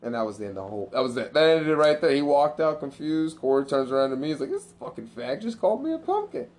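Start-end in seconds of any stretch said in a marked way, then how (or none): 4.5-6.5 mock outrage. Brother quoted outraged.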